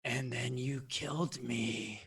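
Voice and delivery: breathy, raspy maze creature; rasping, wheezing creature. Acted. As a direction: answer in a wheezing rasp. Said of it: "rasping, wheezing voice".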